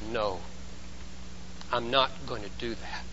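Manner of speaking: low, soft refusal; understated, subdued no; body's refusal. Acted. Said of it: "when it says".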